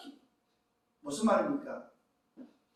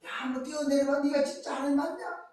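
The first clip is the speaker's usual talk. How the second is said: lightly acted jeer, pitched higher; sarcastic taunt; 10 semitones higher, 3 dB louder than usual. Devil daring Jesus to jump, mocking sarcastic taunt. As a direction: goad them with mock disbelief. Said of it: "비꼬는 거죠".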